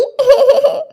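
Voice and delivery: short sobs trailing the words; acted sobbing. Daughter-in-law leaving her in-laws, acted sobbing. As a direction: cry after the farewell.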